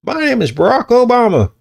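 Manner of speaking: high, put-on presidential voice; Obama impersonation. Podcaster repeating his botched introduction as the president, high hammy Obama voice. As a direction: badly imitate a politician announcing himself, pitch soaring. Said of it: "all mixed in in that impersonation".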